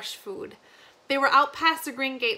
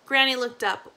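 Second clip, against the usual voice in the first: usual speaking voice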